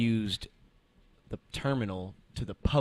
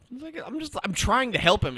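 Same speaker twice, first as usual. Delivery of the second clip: much higher and louder, fed up; venting that you only tried to help; exasperated outburst; 8 dB louder than usual. Real, not acted. Customer ignores her help, exasperated outburst.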